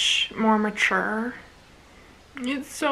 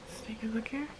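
soft unvoiced whisper; 13 dB quieter, faster than usual. Real, not acted. Vlogger to her camera, a hushed whisper. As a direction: breathe it like a secret. Quiet start of the reaction.

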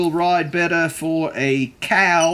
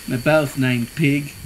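ordinary delivery

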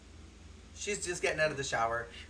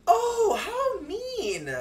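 mock outrage; 7 dB louder, much slower than usual. Real, not acted. Reacting to game event.

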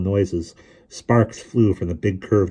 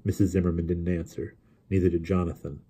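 ordinary delivery, nothing marked

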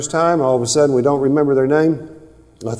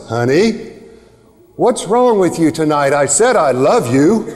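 a louder, half-laughing exasperated partner; a lightly hammed-up spouse asking what's wrong; mock hurt spouse; 5 dB louder than usual. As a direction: play the put-out partner. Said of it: "The tone of the voice".

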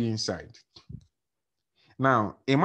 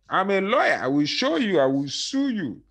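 boasting; 8 semitones higher than usual. Retold or imitated. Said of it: "but he's already boasting".

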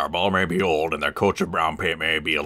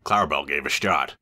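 ordinary delivery, nothing marked

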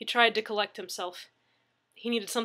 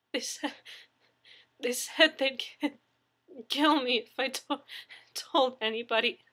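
frightened stammer; 4 semitones higher than usual. Acted. Frightened victim stammers threat.

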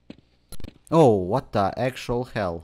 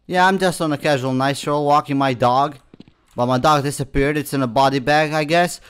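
sarcastic, raised-voice account of events; loud mock explanation; 4 semitones higher, 9 dB louder than usual. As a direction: loudly play along with the absurdity. Mockingly narrating the absurd game situation.

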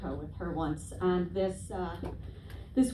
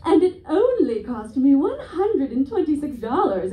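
louder mock proud lady; bragging lady; 4 semitones higher, 6 dB louder than usual. Dog owner boasting the test price, loud and proud. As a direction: boast the price like it's nothing. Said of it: "she's like".

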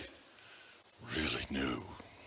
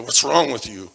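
louder, sharper imitated yell of a father; retold angry shout; 4 semitones higher, 11 dB louder than usual. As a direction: shout it like the furious dad. Father shaking boy retold.

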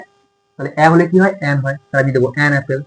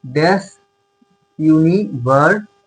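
slow dictation; much slower than usual. Shown as a demonstration. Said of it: "এখানে যদি লিখি".

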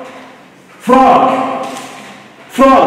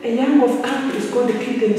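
ordinary talk

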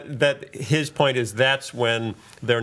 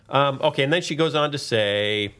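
normal talking voice

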